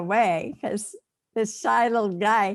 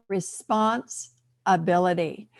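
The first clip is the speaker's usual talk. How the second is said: RESPONSIBILITY drawn out slowly, broken into parts; word said deliberately; 3 semitones lower, slower than usual. Shown as a demonstration. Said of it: "let me say the word again".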